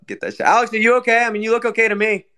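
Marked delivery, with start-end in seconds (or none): 0.1-0.4 much lower, quieter mock growl on get that shit; deeper put-on growl. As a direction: push the growl even lower and softer. Repeats bit even lower.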